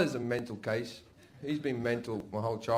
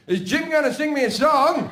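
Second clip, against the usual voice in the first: high, loud grandma call; hollered question from another room; calling from bedroom; 12 semitones higher, 8 dB louder than usual. Retold or imitated. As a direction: imitate grandma shouting through the house. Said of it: "I hear from my bedroom".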